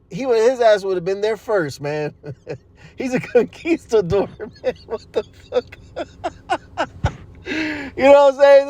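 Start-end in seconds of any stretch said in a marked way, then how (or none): 2.5-8.7 laughing through the words. Real, not acted. Mocking the conquistador, laughing. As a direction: laugh it out in disbelief.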